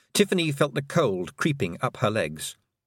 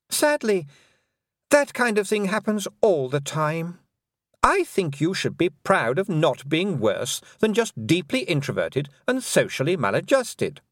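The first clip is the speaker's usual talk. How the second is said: higher, silky voice of a mocking fairy queen; lightly higher, condescending queen; 8 semitones higher, 3 dB louder than usual. A narrator's reading. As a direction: purr it out as the cruel Queen, sweetly taunting.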